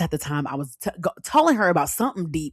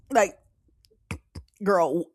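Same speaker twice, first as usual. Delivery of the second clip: imitated choked-up throat catches; barely able to speak. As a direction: act as if tears block the words. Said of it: "I could barely speak".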